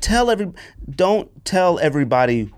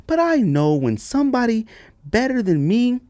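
puzzled reading; 11 semitones higher than usual. Shown as a demonstration.

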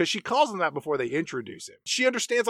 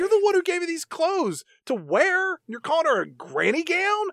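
much higher, indignant complaint; mock outrage; 13 semitones higher, 3 dB louder than usual. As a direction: scold Jake for the gown. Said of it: "And I'm like".

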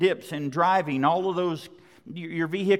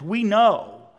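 raised, loud, assured declaration; ringing conviction on a falling close; absolute confidence; 4 semitones higher, 6 dB louder than usual. Acted. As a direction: declare it with unshakable certainty. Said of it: "absolute, unshakable confidence".

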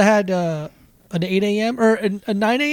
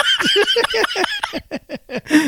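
high laugh. Real, not acted. Laughs at own joke.

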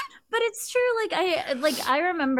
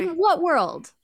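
higher, louder, incredulous; high louder astonishment; amazed disbelief; 7 semitones higher, 4 dB louder than usual. Real, not acted. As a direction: exclaim it in disbelief.

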